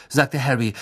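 ordinary delivery, nothing marked

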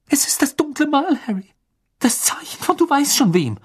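high, anxious voice; scared, high-pitched warning; frightened explanation. A narrator's reading. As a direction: blurt it out in rising fear. Frightened girl explains the mark.

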